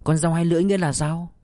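mildly breathless curiosity, hurried and lifted; anxious question. A narrator's reading. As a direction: ask with a touch of nervous anticipation. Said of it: "hồi hộp hỏi".

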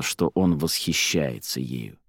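plain reading voice

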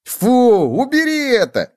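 loud high yuck, then squeal; high-pitched revulsion; disgusted bedbug. A narrator's reading. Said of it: "замахал руками Клоп".

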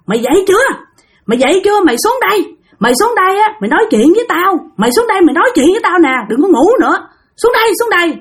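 high, scolding shout to wake her; sister yelling. Retold or imitated. Older sister scolding her sister to get up and come down. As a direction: bark up the stairs to wake her. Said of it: "nghe chị của bạn chửi um sùm".

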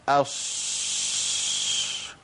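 long hushing أص, between sh and s; بين الشين والصاد. Retold or imitated. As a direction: stretch the end of أص into a spreading shhh. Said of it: "حرف ما بين الشين والصاد". (أص بتفشٍّ طويل كالشين)

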